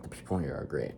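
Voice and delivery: unvoiced, soft sarcastic aside; whispered sarcasm. Real, not acted. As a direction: breathe it out in disbelief. Sarcastic whispered repeat of line.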